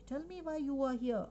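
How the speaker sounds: mildly impatient storybook mother; rather impatient. A narrator's reading. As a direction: read it with a touch of impatience. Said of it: "said Amma rather impatiently".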